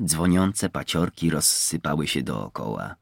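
plain reading voice

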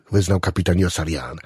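ordinary delivery, nothing marked

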